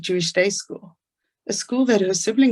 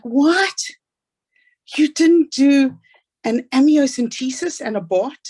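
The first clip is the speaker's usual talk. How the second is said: higher and louder, incredulous neighbor; mock outrage, voice jumping up and loud; retold shock, pushed high and loud with a drawn-out middle; shocked outburst; 5 semitones higher, 5 dB louder than usual. Neighbor's shocked question about abortion.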